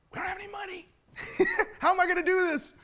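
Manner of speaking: high, thin squeak with a laugh; mock panic. Buyer fretting to himself beside his wife, high squeaky mock panic. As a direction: squeak it out in comic despair. Thinking aloud in mock despair.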